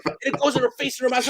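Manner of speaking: ordinary talk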